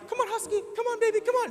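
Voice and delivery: very high-pitched pet-coaxing voice; wife coaxing animal. Retold or imitated. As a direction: imitate a woman sweetly calling a dog, way up high. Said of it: "'cause my wife talks to animals".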